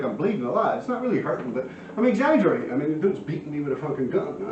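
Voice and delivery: ordinary delivery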